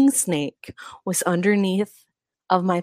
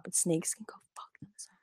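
whispering; 12 dB quieter than usual. Real, not acted. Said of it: "My mom told me I was being too loud".

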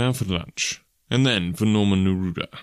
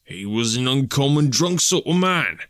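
higher-pitched, mildly put-out working man; somewhat irritated constable; 6 semitones higher, 3 dB louder than usual. A narrator's reading. Constable resents the digression about the drunk, mildly irritated. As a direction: insist with a touch of irritation. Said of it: "appeared to be somewhat irritated".

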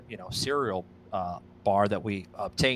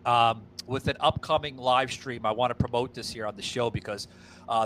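ordinary talk, nothing marked

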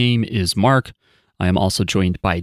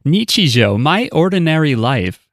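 higher, lilting text-to-speech lady; TikTok narrator; 5 semitones higher than usual. Acted. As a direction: imitate an annoying TikTok voice-over. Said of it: "that annoying TikTok narrator".

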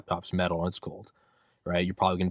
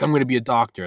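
louder, higher burst of resolve; amped up; 5 semitones higher, 7 dB louder, faster than usual. Acted. Student starting out on medicine, amped up. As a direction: declare it all fired up. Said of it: "you get all amped up".

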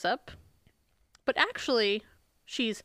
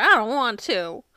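louder mock protest; retold shout; 6 dB louder than usual. Repeating Michelle's refusal for the co-host, mildly shouted imitation. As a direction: imitate a shouted refusal, pitch near your own. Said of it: "And Michelle shouts".

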